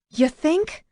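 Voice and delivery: high, dry sarcasm; woman snapping back at the driver; sarcastic. A narrator's reading. Sarcastic retort.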